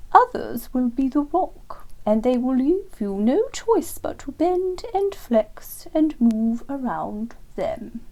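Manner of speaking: slow, high, lofty lecturing owl voice; superior wise owl. Acted. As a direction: explain like a grand old teacher, slowly.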